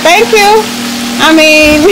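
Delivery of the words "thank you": high, sweet, gracious thank-you. Retold or imitated. Answering old schoolmates' compliment, high and sweetly pleased. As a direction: accept the compliment with delight, pitched way up. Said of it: "I be like".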